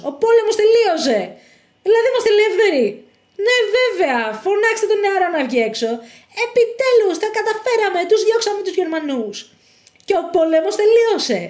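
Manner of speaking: high, exultant announcement; overjoyed, pitched high; jubilant. Acted. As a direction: proclaim freedom at long last. Celebrating the end of the war.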